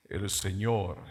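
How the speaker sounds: impostured preacher voice. Shown as a demonstration. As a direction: boom it like a fake televangelist. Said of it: "con voz así".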